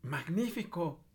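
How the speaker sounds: high, slow admiring exclamation; exclaiming. Acted. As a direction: gush with fake admiration. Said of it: "exclamó Elizabeth". (exclamando con admiración)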